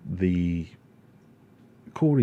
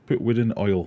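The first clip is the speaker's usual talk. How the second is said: a lighter Sheffield repeat; Sheffield saying; 4 semitones higher, 7 dB louder than usual. Retold or imitated. Streamer repeating the Sheffield quiz phrase, light Sheffield accent. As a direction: say "Put wood in oil." again in a milder Sheffield accent, hitting put. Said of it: "There you go, that'll be the next quiz".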